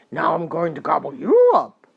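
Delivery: very loud troll threat; roaring troll. A narrator's reading. Troll threatens to gobble the second goat, roaring very loudly. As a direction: roar the threat as a hungry troll. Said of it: "roared the troll".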